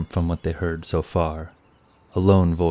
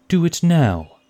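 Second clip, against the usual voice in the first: higher and louder, a final firm command; unbending order; 12 semitones higher, 6 dB louder, slower than usual. Acted. Warden insists the torches be lit now, unbending. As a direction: cut off all argument. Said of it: "his tone unbending".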